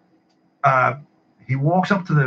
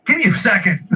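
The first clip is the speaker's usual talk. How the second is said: higher-pitched mock exasperation; retold scream at clerk; 7 semitones higher than usual. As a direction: re-enact a mild hungover outburst. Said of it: "I screamed at her".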